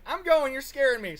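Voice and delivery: hammed-up frightened wife voice; mock scared wife. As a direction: squeal it like a frightened wife hanging up. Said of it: "she's like".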